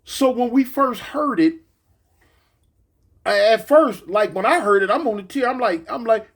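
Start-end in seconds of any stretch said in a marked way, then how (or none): none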